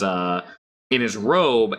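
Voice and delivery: normal talking voice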